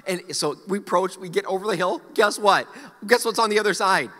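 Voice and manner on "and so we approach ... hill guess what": ordinary talk, nothing marked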